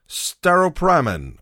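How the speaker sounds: Staropramen drawn out as "starro-PRAM-en"; trial pronunciation. Shown as a demonstration. Unsure podcaster naming his Czech lager, trial pronunciation. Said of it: "How do you pronounce that?".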